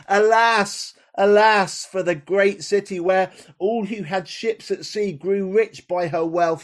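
wailing lament; lamenting cry. Acted. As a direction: cry it out in grief for the fallen city. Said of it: "crying aloud".

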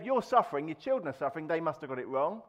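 usual speaking voice

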